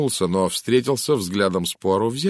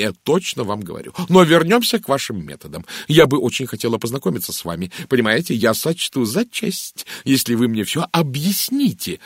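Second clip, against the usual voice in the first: higher, louder, eager chatterbox lady; excitable society chatterer; gushing Mrs Gardner; 3 semitones higher, 4 dB louder than usual. Acted. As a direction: gush at him with lively swoops. Mrs Gardner chattering to Poirot in story.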